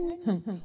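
soft menacing chuckle under the breath; quiet villain laugh. A narrator's reading. Asem gloating alone at night, quiet evil laugh.

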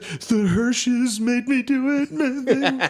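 put-on high, slow, whiny teen blaming others; whiny kid excuse. Teen blaming neighbour kids to his mom, high and whiny. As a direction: whine it like a caught kid.

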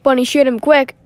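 loud urgent command; loud battle command; shouted order. Acted. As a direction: bark it mid-fight. Combat order.

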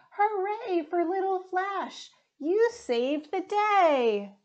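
cheering crowd. Acted. Cheering celebration.

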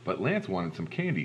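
usual speaking voice

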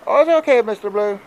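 high, loud squeaky put-on voice; answering as Ghost Rider. Ghost Rider answering Mister Blue, in a high silly put-on voice. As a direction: pipe up as the pet answering its owner.

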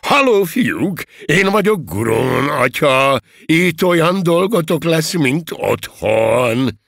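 high, loud, drawn-out hearty greeting; over-hearty fatherly welcome; jovial encouragement. A narrator's reading. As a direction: welcome the recruits like a fatherly host, booming. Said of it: "biztatóan üdvözölte".

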